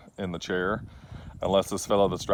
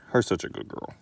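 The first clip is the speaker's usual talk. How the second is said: soft breathy whisper to the dog; 5 dB quieter than usual. Real, not acted. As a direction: whisper sweetly to a pet. Baby talk to the dog.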